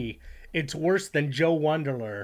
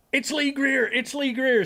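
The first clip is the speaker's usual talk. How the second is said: higher, excited repeated call; excited Dell quote; 10 semitones higher, 4 dB louder than usual. Retold or imitated. Streamer quoting Dell excited at the monitor, higher excited call. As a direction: point at the screen and exclaim it. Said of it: "says August Lyon".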